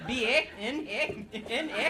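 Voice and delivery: high Canadian "eh?" after each bit; mock Canadian accent. Comic teases man from Calgary, mock Canadian accent. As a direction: spell it out as a hammed-up Canadian. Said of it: "that was a Canadian accent".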